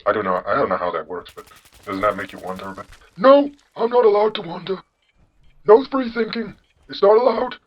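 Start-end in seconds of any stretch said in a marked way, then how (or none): 3.2-7.5 put-on high, loud old-man falsetto; [name]. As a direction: refuse firmly in a cartoonish old man's voice. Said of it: "you have the generic old man voice".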